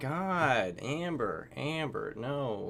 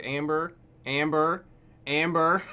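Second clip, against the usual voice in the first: louder, drawn-out calling of Amber; 2 semitones higher, 6 dB louder than usual. Retold or imitated. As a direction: call her name over and over. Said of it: "I'm just like".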